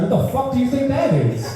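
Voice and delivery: high, laughing disbelief; half-laughing mock outrage; laughing outburst.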